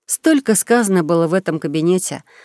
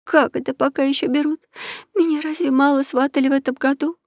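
much higher, whiny fake plaint; fake moaning; 8 semitones higher than usual. A narrator's reading. Countess faking tears to the king, whiny moan. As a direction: moan as if holding back tears. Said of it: "простонала".

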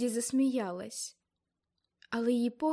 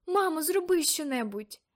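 high, loud desperate plea; pleading cry; 6 semitones higher, 5 dB louder than usual. Acted. Girl begging her mother to act, high desperate cry. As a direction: beg her mother to help.

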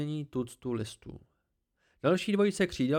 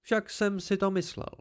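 higher, smug voice of the hidden Cthaeh; haughty tree creature; 7 semitones higher than usual. Acted. The Cthaeh corrects the boy, higher and smug. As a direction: talk down to the boy from the branches, pleased with yourself.

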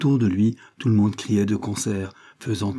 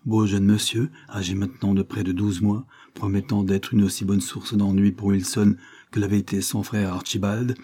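usual speaking voice

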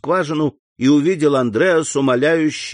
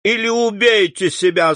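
higher, stern pronouncement; холодный приказ; 8 semitones higher than usual. A narrator's reading. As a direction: command him like a vestal signaling death. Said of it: "с видом весталки".

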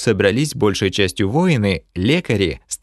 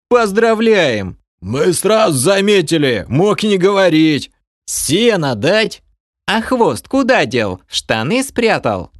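mocking jeers; 9 semitones higher, 4 dB louder, slower than usual. Crowd jeering at porter.

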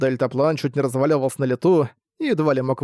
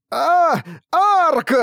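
high, loud falling shriek; terrified scream; 13 semitones higher, 4 dB louder than usual. Acted. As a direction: shriek his name in terror. Буксил летит вниз. (испуганный визг)

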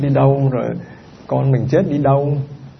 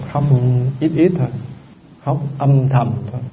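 normal talking voice

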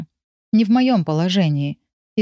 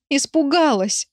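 hysterical crying; 8 semitones higher than usual. Acted. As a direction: wail it in hysterics. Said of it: "вместе с криком".

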